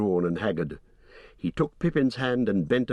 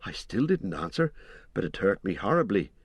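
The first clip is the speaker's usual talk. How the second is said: usual speaking voice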